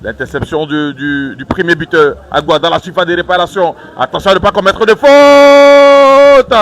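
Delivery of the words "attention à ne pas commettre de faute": high, loud and slow warning; urgent warning. Real, not acted. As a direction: call out the danger. Danger in the box. (avertissement pressant)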